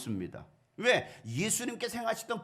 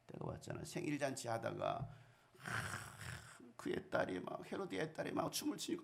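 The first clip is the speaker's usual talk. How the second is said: much softer, conspiratorial telling; hushed storytelling; 3 semitones lower, 10 dB quieter than usual. A narrator's reading. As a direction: drop to a secret, unvoiced hush.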